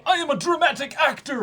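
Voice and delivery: hammed-up high, loud dramatic actor; hammy actor. Imitating a clumsy samurai's fake drama, hammed-up high loud. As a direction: boom it like an overacting stage ham. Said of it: "played really well into the".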